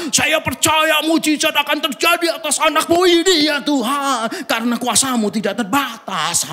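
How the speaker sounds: high, emphatic mock pastor prayer; hammed-up fiery healing prayer; fervent loud preacher. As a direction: pray like a revival preacher at full volume. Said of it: "dengan semangat intonasi yang tinggi".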